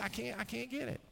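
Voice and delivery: put-on a kid giving up, high and small; helpless son. Himself as despairing son.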